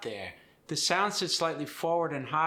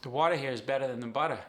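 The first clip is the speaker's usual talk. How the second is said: fast, easygoing New Zealand accent; faster than usual. Shown as a demonstration. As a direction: soften every T, like "wadda", "bedda", "budda". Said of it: "It softens".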